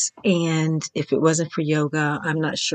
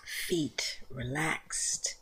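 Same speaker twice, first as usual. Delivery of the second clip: slow breathy whisper, barely voiced; 3 semitones lower, 14 dB quieter, much slower than usual. Teacher guiding a body scan, in a slow whisper. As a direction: whisper it as if not to wake anyone.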